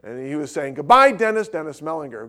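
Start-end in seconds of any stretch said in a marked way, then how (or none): 0.7-1.5 higher, louder farewell call; calling goodbye. Retold or imitated. Dad calling goodbye to friend across lot, raised call.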